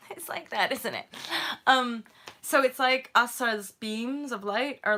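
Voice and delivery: ordinary talk, nothing marked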